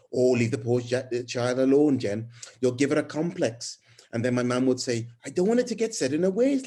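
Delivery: put-on a self-confessed awful Welsh accent for a chatty young mum; a hammed-up, amateur Welsh accent. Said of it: "a really awful Welsh accent".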